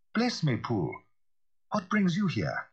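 high-pitched exclamation at a visitor; surprised cry. A narrator's reading. Lawyer greets an unexpected visit from Poole, crying out. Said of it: "he cried".

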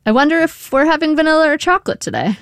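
high, airy musing; diverting. A narrator's reading. Daughter diverting dad toward ice cream, lightly musing. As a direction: wonder aloud to change the subject.

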